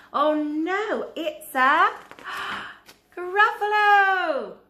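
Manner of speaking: loud, high, building dread with a gasp; horrified discovery. Acted. Mouse suddenly meets the Gruffalo, horrified. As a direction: build to a loud drawn-out cry of alarm.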